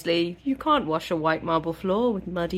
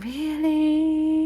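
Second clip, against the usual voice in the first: mock surprise; 10 semitones higher than usual. Feyre doubts Rhysand.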